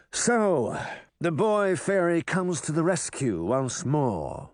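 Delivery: measured, mocking character voice; silky villain toying with his foe; Mirror, smug villain. A narrator's reading. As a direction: taunt a young rival, taking your time. Narrator voices Mirror, taunting.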